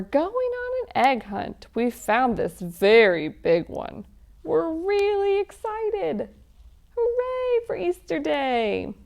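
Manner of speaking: bouncy, sing-song picture book chant; lively read-aloud for children. Acted. Reading an Easter egg hunt story to children, sing-song. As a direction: read it like a game for little ones.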